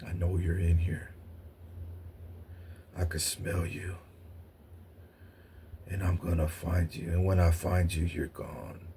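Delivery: low, quiet, growly menace fading to a near hush; menacing bear. Retold or imitated. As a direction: loom over them like a hunting beast. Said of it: "I hear the bear say".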